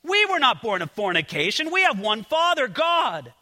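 lifted pulpit reading voice; scripture reading. Acted. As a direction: proclaim the verses to the congregation. Reads scripture passage aloud.

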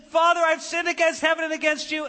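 high, loud mock-tearful plea; acted tearful confession. Son confessing to his father, acted tearful plea. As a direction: blurt the confession, imitating tears lightly. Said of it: "begins to say it through his tears".

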